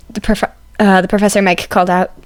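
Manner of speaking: usual speaking voice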